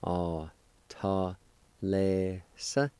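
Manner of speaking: slow separate syllables, lifting at "sa"; measured teaching pronunciation; syllable-by-syllable demonstration. Shown as a demonstration. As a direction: sound out the second part piece by piece. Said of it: "Second part".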